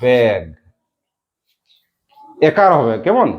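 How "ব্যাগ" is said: ব্যাগ with its vowel held long; ekar vowel. Shown as a demonstration. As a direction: stretch ব্যাগ as a slow model. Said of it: "কি উচ্চারণ হয়?".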